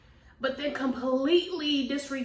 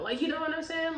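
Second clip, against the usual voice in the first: usual speaking voice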